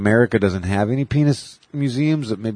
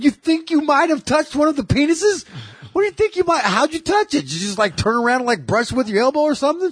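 high, pitched-up angry Boston rant; hammed-up irate comic ranting about his wife; Bill Burr rant. Retelling a Bill Burr bit in his high, angry voice. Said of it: "He talks like he's fucking pissed off".

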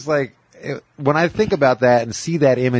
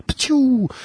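high cartoon splat sound; cartoon crash noise. The drunks hitting the ground, a high cartoon crash noise. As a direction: make the pratfall sound effect. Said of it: "they went".

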